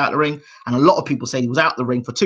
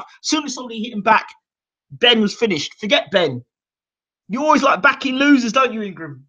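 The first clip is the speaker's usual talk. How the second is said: dad taunting; 9 semitones higher than usual. Retold or imitated. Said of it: "my dad started laughing at me".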